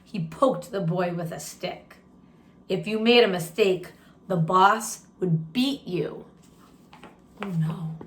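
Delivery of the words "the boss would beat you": usual speaking voice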